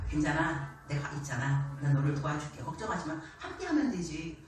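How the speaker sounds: low, soft, comforting God; gentle reassurance. Acted. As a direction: soothe the worker who keeps failing.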